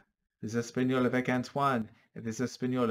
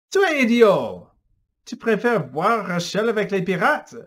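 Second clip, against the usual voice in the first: angry; 10 semitones higher, 12 dB louder than usual. Acted. Antoine insulting Philippe.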